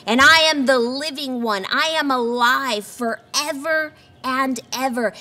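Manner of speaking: loud proclaiming preacher; preaching. Acted. Preacher proclaiming Jesus' words loudly to the congregation. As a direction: ring it out triumphantly.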